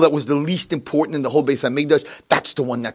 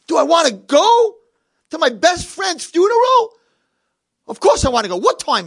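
outraged shout; 6 dB louder than usual. Acted. Blind man outraged at question.

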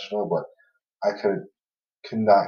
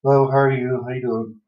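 cheery greeting; 4 semitones higher, 4 dB louder than usual. Retold or imitated. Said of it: "go over the whole spiel".

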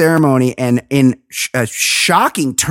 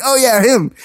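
mock recognition; 9 semitones higher than usual. Mocking viewer recognizing someone.

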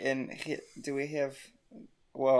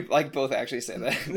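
ordinary talk, nothing marked

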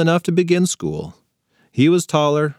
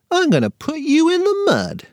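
put-on much higher, childish little-boy voice; toddler threat voice. A narrator's reading. Little brother threatening his sister in a high toddler voice. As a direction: pipe it up like a tiny tot.

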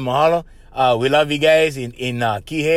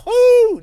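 sudden high cheering whoo, louder; excited whoo; 5 dB louder than usual. Acted. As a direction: whoop like a fan in the crowd. Cheering a state shout-out.

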